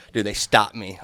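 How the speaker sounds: ordinary talk, nothing marked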